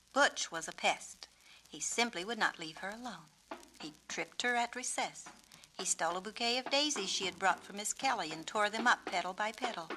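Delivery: building from calm to a fast, loud, high rant; winding herself up, speeding along. A narrator's reading. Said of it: "She talked faster and faster".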